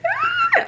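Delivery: very high squeal of delight; a sudden squeal before opening the box; excited squeal. Real, not acted. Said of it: "I'm so excited".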